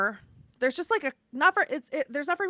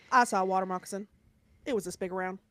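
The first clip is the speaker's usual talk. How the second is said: a hint of a deadpan little swamp boy, lower and softer; boy impression; 5 semitones lower, 4 dB quieter, faster than usual. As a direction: poorly imitate the boy who shows no emotion, low and soft. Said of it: "I'm gonna do an impression of this boy poorly".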